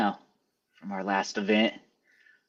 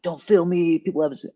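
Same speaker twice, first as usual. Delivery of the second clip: higher-pitched imitation of fussy comics; lightly acted complaint, pitched up; mock protest; 8 semitones higher than usual. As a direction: mimic a performer objecting to cameras. Mimics comics objecting to being filmed.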